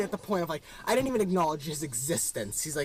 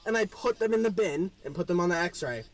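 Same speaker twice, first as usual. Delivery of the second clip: ordinary talk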